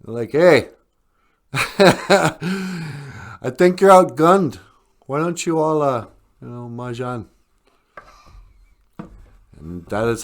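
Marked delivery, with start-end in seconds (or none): 0.3-7.3 mock taunting. Indians with rifles taunt.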